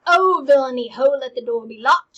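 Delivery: loud furious order; outraged command. Acted. Hamlet ordering the doors locked, loud outraged. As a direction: bark it to the whole court.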